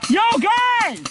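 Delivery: high, urgent warning yell; alarmed shout. Real, not acted. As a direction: warn the team, urgent. Alarm, team being flanked.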